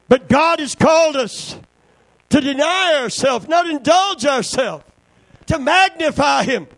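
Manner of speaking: loud, high shouted preaching; preaching at full force. Acted. Preacher shouting about commitment to the congregation. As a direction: build to the cry on body.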